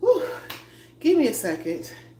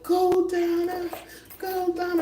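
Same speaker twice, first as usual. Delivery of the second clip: high squealing cheer from the fans; cheering viewers; 14 semitones higher, 3 dB louder, slower than usual. Retold or imitated. Fans cheering Donna on from the sidelines, high squealed cheer. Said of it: "Y'all be like".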